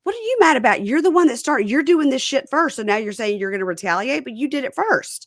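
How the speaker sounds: imitating a heated complaint; retold rant. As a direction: quote the angry rant loud and high. Retold quarrel, angry quoted rant.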